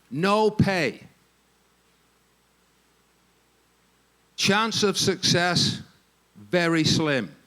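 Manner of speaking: slow, weighty recitation with long pauses; dramatic, measured advertisement reading; quoted advertisement. Acted. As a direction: read out each stark item slowly. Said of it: "I'm quoting verbatim".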